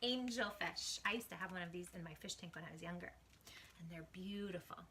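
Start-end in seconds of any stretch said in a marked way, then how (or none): 2.0-4.8 whisper. Real, not acted.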